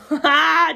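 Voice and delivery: loud, high burst of laughter; cracking up. Real, not acted. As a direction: burst out laughing mid-line. Cracks up at own line.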